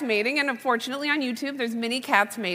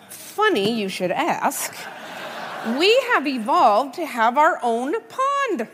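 slow, careful mock-lecture to a child; slow, over-careful explaining to her daughter; much slower than usual. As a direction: spell it out slowly like a patient mom.

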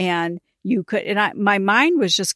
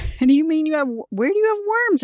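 rising astonishment, nearly squeaking; astonished; 8 semitones higher, faster than usual. Retold or imitated. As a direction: pitch climbing much higher in disbelief. Repeating her astonished question.

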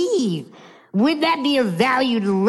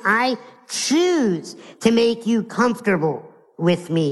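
ordinary talk, nothing marked

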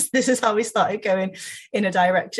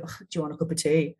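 reluctant offer; faster than usual. Shown as a demonstration. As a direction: sigh it out as if it's a chore. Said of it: "Or you can go".

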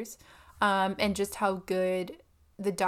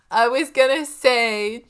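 laughing exasperation; 2 semitones higher, 8 dB louder than usual. Real, not acted. Stumbles again, laughing.

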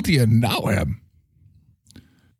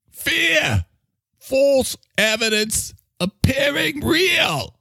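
put-on high, slow, shouted mixed pirate-Cockney accent; pirate-Cockney proclamation; much slower than usual. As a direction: bellow the slogan like a cockney pirate. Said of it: "Australian, Cockney, or pirate".

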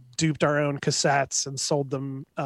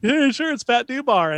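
much higher, mocking disbelief; sarcastic higher mock disbelief; 8 semitones higher than usual. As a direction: roll your eyes at the prank. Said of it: "You know, and".

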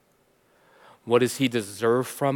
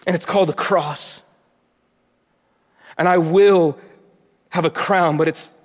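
louder, higher, driving sermon voice; full-force preaching; 7 semitones higher, 7 dB louder than usual. Acted. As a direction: preach it with rising fervor. Preaching builds again.

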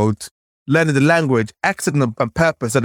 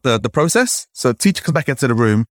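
usual speaking voice

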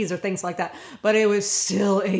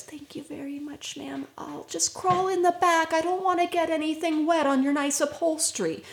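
put-on timid girl; 6 semitones higher than usual. Girl thanks them.

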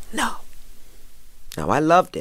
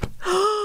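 quick delighted gasp; sharp gasp. Real, not acted. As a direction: gasp in happy surprise. Remembers Michelle knowing her.

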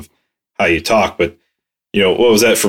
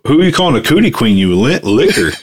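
mock PG insult; 3 semitones higher than usual. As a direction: snap it like a prissy commercial insult.

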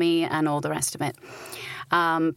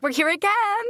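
bouncing back; 12 semitones higher than usual. Retold or imitated. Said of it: "We're like".

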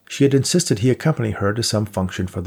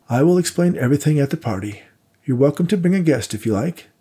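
put-on lightly raised, polite old gentleman voice; a courteous old donor, a bit higher; elderly benefactor; 6 semitones higher than usual. A narrator's reading. Held voice for Worthington.

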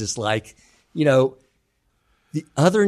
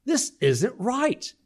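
dawning realization; 11 semitones higher, much slower than usual. Retold or imitated. Friend's sudden realization.

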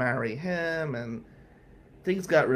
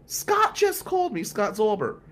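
high, loud, frantic aunt's scream; 4 dB louder than usual. Retold or imitated. Aunt screaming at Billy on the voicemail, high and shrill. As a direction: shriek it like a panicked aunt on voicemail. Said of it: "Aunt Mare screaming".

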